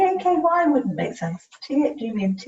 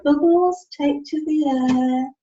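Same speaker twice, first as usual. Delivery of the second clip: louder, drawn-out dictation; slow dictation; 2 semitones higher, 5 dB louder, slower than usual. Shown as a demonstration. Helper urges Chris, dictating louder and slowly. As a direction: push each word out slowly and firmly. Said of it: "helper dictating louder, slowly".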